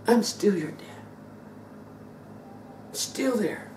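ordinary talk

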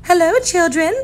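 high, sweet greeting; teacher greeting her class sweetly. Acted. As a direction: greet them kindly and gently. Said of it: "someone said in a sweet voice".

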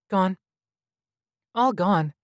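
put-on high, sorrowful child; small boy mourning, pitched high; child hologram in grief. A narrator's reading. Hologram answering about the lost caretakers, high and sorrowful.

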